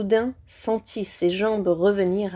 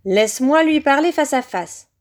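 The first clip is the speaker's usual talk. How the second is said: high, commanding master's voice; high-pitched voice; 5 semitones higher, 4 dB louder than usual. A narrator's reading. Hidden master demands to face the boy, high-pitched. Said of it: "La voix aiguë s'éleva à nouveau".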